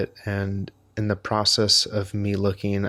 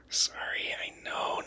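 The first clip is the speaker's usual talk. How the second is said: whispering; 13 dB quieter than usual. Real, not acted. Muttering to himself.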